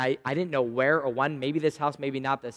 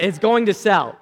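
louder and higher, certain; bold insistence; 7 semitones higher, 12 dB louder than usual. Retold or imitated. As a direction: insist it will sell. Retold confident claim to seller.

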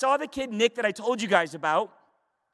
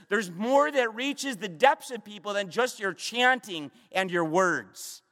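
usual speaking voice

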